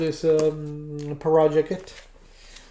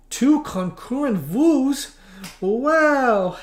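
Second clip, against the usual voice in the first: mock surprise; 7 semitones higher, much slower than usual. Real, not acted. Mock amazed at two viewers.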